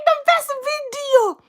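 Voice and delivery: very high, laughing squeal; squealing delight. Acted. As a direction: squeal it through laughter, drawn out at the end. Reenacts own delight at video.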